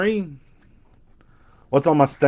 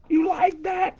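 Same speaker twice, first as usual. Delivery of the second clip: much higher mock squeal of the catchphrase; imitating excited scream; 6 dB quieter than usual. Breaker imitating his hyped pull on Whatnot, squealing high. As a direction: squeal it like a thrilled fan.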